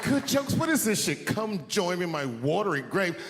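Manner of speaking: high-pitched indignant wife reading aloud; retold angry wife. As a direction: imitate an outraged wife, voice up high. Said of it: "she was mad at me".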